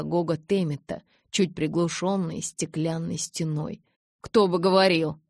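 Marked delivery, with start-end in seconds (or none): 4.2-5.2 grumbled retort. Acted. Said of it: "буркнул Джейкоб".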